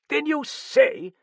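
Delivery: very high, loud, drawn-out challenge; incredulous cry. A narrator's reading. Sir John challenging the Frenchman's claim, incredulous. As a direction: cry out in disbelief. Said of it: "Sir John cried".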